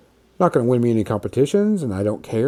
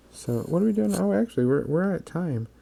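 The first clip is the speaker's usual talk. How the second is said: ordinary talk, nothing marked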